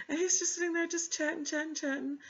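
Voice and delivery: laughing while talking. Real, not acted. Still laughing, pitched high.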